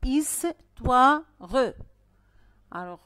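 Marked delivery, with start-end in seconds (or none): 0.0-1.2 "histoire" loud, split his-toi-re; syllable by syllable. Shown as a demonstration. Teacher has pupils clap the word, syllable by syllable. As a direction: clap out histoire in three separate beats. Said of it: "Frappez histoire".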